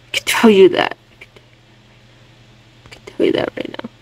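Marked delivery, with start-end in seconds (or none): 2.9-3.9 quick unvoiced whisper; fast whisper. Shown as a demonstration. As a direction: rush it out in a whisper. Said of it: "repeats as whisper".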